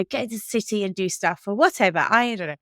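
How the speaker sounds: usual speaking voice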